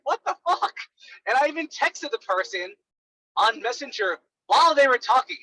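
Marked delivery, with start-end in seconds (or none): 0.0-0.7 high, stunned exclamation; incredulous what the fuck. Retold or imitated. After a rambling mock call, high disbelief. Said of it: "I was like".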